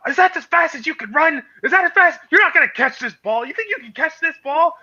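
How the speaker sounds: high mock coach bellow at a running player; coach yelling. Coach taunting a sprinting receiver, high mocking yell. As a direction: bark it like a coach chasing you.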